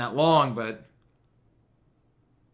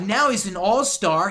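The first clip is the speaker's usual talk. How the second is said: emphatic hype; 6 semitones higher, 6 dB louder, slower than usual. Real, not acted. Hyping the player's position.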